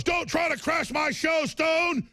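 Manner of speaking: high, shouted warning across the hall; retold yell. Host yelling at Stone as he walks past, mock shout. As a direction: bark it out like a threat from twenty feet away. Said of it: "he goes".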